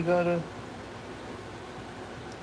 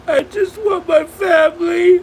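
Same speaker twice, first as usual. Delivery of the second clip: louder, high sobbing plea; crying out; 9 semitones higher, 6 dB louder than usual. Real, not acted. Streamer pleading to viewers, loud real sobbing. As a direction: cry it out through tears.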